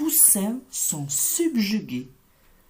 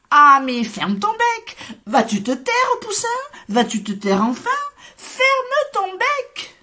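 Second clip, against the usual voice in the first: loud scolding shout, pitched high; shouting together; 13 semitones higher, 6 dB louder than usual. A narrator's reading. Farm animals shouting down the little chick, loud and high. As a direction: yell at the chick to shut up. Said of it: "se mettent à crier".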